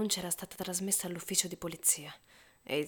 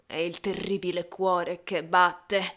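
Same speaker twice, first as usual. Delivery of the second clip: slow, heavy and loud; slow loud dread; 9 dB louder, slower than usual. Acted. Narrator naming the beating heart, slow and loud. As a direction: drag each word out in horror. Climax of the confession.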